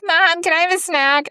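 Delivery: put-on high, pleading child; whiny kid voice. Imitating her kids interrupting work, high whiny voice. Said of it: "my kids are like".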